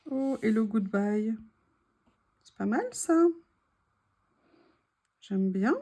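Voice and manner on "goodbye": ordinary talk, nothing marked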